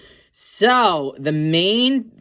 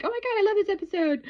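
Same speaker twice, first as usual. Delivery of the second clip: high, fast gushing fan voice; mock gushing; faster than usual. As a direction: squeal it like an overexcited fan. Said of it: "not fantastic and like".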